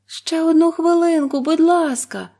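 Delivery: high, loud pleading; wife begging her husband. A narrator's reading. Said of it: "попросила його дружина".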